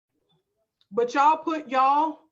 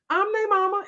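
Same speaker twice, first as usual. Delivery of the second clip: high, firm declaration; assertive; 8 semitones higher, 4 dB louder than usual. Shown as a demonstration. Mother rehearsing a reply to doubters, assertive. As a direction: say it like it settles the matter. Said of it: "say it just like that".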